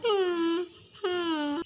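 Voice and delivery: quiet little crying hums, held back; soft whimpering sobs. Acted. Scared little girl whimpering while her brother comforts her. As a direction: whimper softly like a frightened child.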